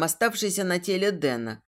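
usual speaking voice

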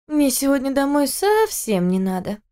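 high, slow tipsy exclamation; cheeky drunk girl; drunk girl exclaiming. Acted. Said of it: "воскликнула она".

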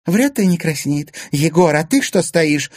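high, loud indignant scolding; outraged. A narrator's reading. Anfisa goading Egor to act, outraged. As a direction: round on him in outrage. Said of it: "возмутилась Анфиса".

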